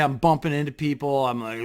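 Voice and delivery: normal talking voice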